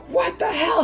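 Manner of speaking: high startled outburst; shocked mom. Retold or imitated. Mom shocked by the producer, high outburst. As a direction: react in disbelief. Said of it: "She's like".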